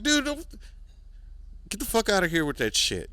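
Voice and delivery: high-pitched exasperated scolding. Retold or imitated. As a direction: squeal it like you can't believe him. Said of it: "I was like".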